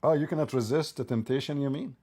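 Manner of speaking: ordinary talk, nothing marked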